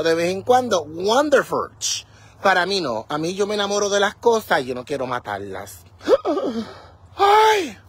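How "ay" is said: sharp high gasp then a cry of ay; shocked gasp. Real, not acted. Gasping at what she just found in the garden.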